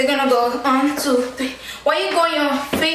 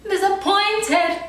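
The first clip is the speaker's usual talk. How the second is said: exasperated; 6 semitones higher, slower than usual. Real, not acted. Frustrated at forgetting dance.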